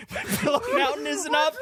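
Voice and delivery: high laughing cry of despair. Real, not acted. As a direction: laugh through the words. Laughing while telling sad story.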